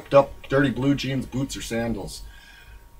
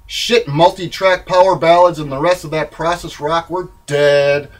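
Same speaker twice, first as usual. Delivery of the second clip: usual speaking voice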